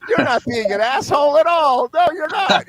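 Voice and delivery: high, loud, over-cheerful host; forced jolly. Retold or imitated. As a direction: put on jolly helpfulness over hidden anger. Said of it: "trying to be helpful and jolly".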